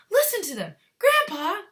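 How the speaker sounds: put-on a boy bursting with curiosity; excited cry. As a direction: Charlie pleading with Grandpa, high and excited. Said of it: "cried Charlie".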